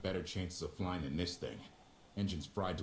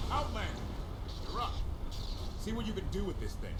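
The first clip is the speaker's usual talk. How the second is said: high, loud call, then faster; 12 semitones higher, 3 dB louder, faster than usual. Acted. Pilot shouting for the Outlander to take over, loud and urgent.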